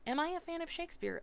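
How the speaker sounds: high, quick British echo of a question; British accent. A narrator's reading. New pupil repeating teacher's question with relish, British accent. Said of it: "still speaking in my British accent".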